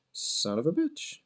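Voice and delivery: slow, very soft swearing to yourself; hushed, rising grumble; muttered curse. Real, not acted. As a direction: curse under your breath, drawn out. Cursing while hunting for a brush.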